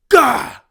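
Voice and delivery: high, loud flexing grunt; strained grunt. Pretend. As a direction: grunt like you're pumped. Flexing, jacked.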